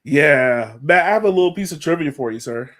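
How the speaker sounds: normal talking voice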